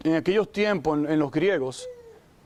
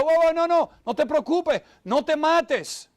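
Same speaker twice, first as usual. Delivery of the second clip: urgent raised shout, pitch jumping up; shouting to stop him; 9 semitones higher, slower than usual. Retold or imitated. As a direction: call out to stop the jailer, pleading. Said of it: "gritó y dijo".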